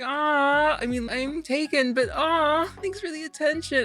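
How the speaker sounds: flustered thanks. Retold or imitated. Customer thanks a hardware store clerk, high and flustered. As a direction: playfully fend off a clerk. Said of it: "And it's just".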